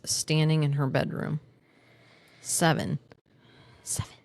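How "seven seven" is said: fading repeat. Pretend. Podcaster joking with a Friends bit, fading echo. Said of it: "Sorry, little Friends thing".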